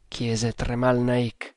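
ordinary delivery

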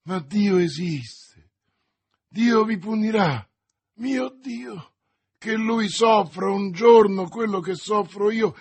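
anguished curse. A narrator's reading. Dying soldier calls God's punishment on D'Artagnan, anguished curse. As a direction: call down punishment from your agony.

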